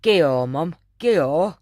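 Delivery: put-on loud Yorkshire kid's whine; daughter's Yorkshire accent. Mum imitating her daughter's Yorkshire, loud. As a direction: mimic the daughter's broad Yorkshire. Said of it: "she'd go".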